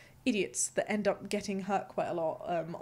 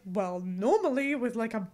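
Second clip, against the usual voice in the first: mock indignant self; 4 semitones higher, 3 dB louder than usual. Acting her past reasoning.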